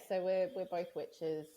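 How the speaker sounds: ordinary talk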